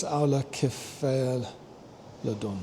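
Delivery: Aulocephalodon sounded out very slowly, syllable by syllable; slow careful attempt. Real, not acted. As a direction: carefully spell out Aulocephalodon aloud. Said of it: "oh, I can't say this".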